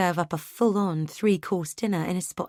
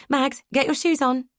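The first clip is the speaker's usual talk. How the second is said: calling through to the next room, higher and louder; mother calling her daughter to get her shoes on, raised voice; 8 semitones higher, 3 dB louder than usual. A narrator's reading. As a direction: call to a child in another room. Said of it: "I call through to Maggie".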